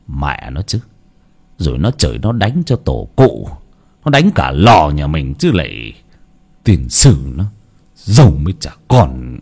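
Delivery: put-on low, muttered old man's curse; an old man grumbling under his breath; deep grouchy grandpa voice; grumbling grandfather. A narrator's reading.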